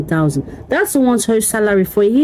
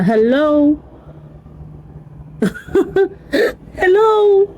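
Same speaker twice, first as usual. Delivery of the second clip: incredulous hello; 10 semitones higher, slower than usual. Real, not acted. Incredulous at pastor's demand.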